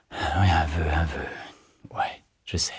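low, soft, fading into a whisper; to himself. A narrator's reading. Kiki musing alone before his wish, sinking to a whisper. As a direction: mull it over under your breath, ending whispered.

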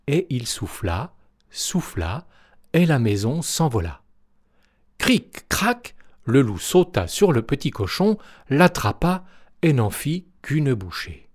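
plain reading voice

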